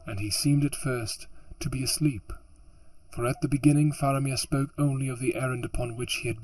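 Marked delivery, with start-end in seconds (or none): none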